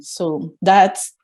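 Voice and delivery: normal talking voice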